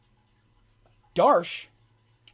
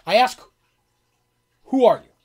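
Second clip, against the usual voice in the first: loud, raised-pitch interrogation; forceful demand; 8 semitones higher, 15 dB louder than usual. Acted. Gully dwarf interrogating tied-up captives, forceful shout. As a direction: press the question hard at full volume. Captor repeats demand to prisoners.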